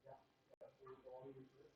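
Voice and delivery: distant, muffled, far from the mic. Real, not acted. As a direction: sound muffled and hard to hear. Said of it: "muffled voice".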